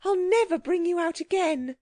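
high-pitched pleading warning; appealing. A narrator's reading. Girl warns the stubborn gentleman, appealing to him. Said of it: "appealed Anthea".

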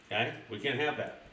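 plain reading voice

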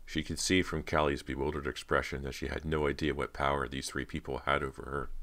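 usual speaking voice

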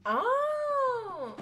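sudden startled oh; startled cry. Real, not acted. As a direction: cry out in surprise. Startled in game.

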